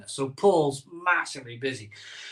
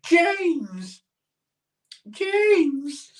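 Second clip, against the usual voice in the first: breathy swooning; 12 semitones higher than usual. Pretend. Bond girl sighing his name.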